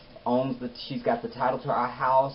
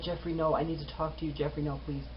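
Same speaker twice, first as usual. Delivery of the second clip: small, higher, much softer begging voice; soft pleading; 3 semitones higher, 7 dB quieter than usual. Retold or imitated. Woman begs her man to let her talk, soft pleading. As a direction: plead gently, imitating a desperate woman.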